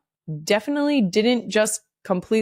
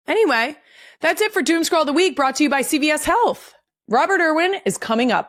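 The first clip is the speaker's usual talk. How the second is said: higher, fast, bright host read; host segment read; 8 semitones higher, faster than usual. Acted. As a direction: sign off the segment like a show host.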